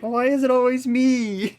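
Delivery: high, loud whining complaint; whiny Sven impression. Teasing friend mimics Sven's put-upon whine. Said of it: "you're like".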